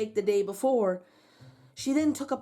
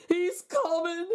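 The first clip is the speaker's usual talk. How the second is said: frightened giant; 7 semitones higher, slower than usual. Acted. Giant frightened by trembling hill.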